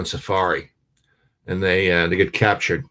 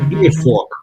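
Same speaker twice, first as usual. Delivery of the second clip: higher, louder Brooklyn demand; Brooklyn accent; 9 semitones higher, 7 dB louder, faster than usual. Retold or imitated. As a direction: bark it like a pushy New Yorker. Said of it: "Guy from Brooklyn says".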